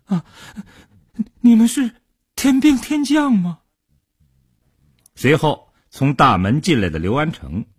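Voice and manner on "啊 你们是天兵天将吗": much higher, startled question; involuntary outburst. A narrator's reading. Captive facing gun muzzles, blurting a startled question. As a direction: blurt it out high, caught off guard. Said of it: "情不自禁地问道".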